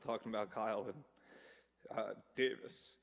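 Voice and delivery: choked up, trailing off quietly; real emotion welling up, voice dropping away; fighting back tears while speaking; getting emotional. Real, not acted. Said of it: "I'm gonna get emotional".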